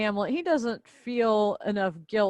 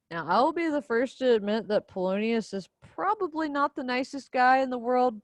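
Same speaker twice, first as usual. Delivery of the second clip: normal talking voice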